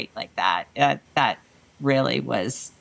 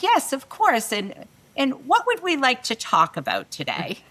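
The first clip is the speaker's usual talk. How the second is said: higher, bright fake-confident presenter; put-on cheerful confidence; 7 semitones higher than usual. Presenter greeting ninety managers, higher put-on confidence. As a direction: sound sure while panicking inside.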